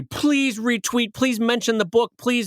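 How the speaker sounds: hammed-up begging for retweets; mock pleading. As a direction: plead like a desperate cartoonist.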